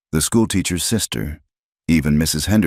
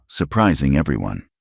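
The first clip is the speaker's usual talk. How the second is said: ordinary delivery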